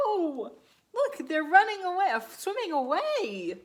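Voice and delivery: high, loud urgent no; excited alarm. Acted. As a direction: warn the child playfully. Warning, fish flee shark.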